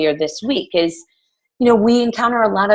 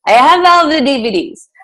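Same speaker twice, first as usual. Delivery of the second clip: hammed-up German accent, higher and louder; 6 semitones higher, 5 dB louder than usual. Coach imitating her German client for the team, exaggerated German accent. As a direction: put on a loud, unsure mock German accent. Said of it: "I don't know if that's a German accent".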